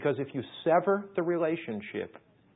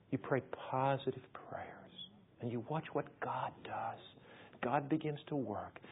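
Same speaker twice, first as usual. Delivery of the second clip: hushed; 9 dB quieter than usual. Real, not acted.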